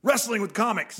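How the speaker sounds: loud, high wrestling promo. Acted. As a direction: hype it up like a ring promo. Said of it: "promo, uh, cut one".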